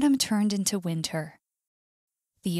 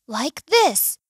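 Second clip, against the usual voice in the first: higher, louder eager bird; 5 semitones higher, 7 dB louder than usual. A narrator's reading. Bird teaching the whale to fly, eager. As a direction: show off eagerly, pitch lifted.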